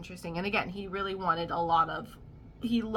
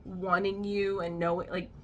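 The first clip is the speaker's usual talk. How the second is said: ordinary delivery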